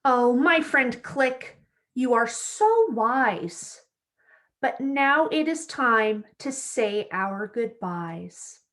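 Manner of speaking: bright, lively storybook read-aloud; reading to children. A narrator's reading. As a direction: read it like story time for little kids. Reads picture book to children.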